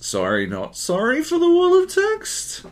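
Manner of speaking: "text" sent soaring up as a question; hammed-up high rising finish on "text"; exaggerated questioning tune. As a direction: make the apology a mock question, very high on "text". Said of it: "you just need a question mark at the end".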